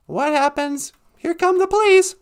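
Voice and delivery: ordinary talk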